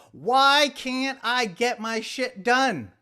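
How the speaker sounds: loud, slow, drawn-out question; mock-dramatic plea. Mock-dramatic posing of the episode's question.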